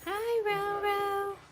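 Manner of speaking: high, slow sing-song coo at the puppy. Real, not acted. Breeder greeting her puppy Ronan in high baby talk.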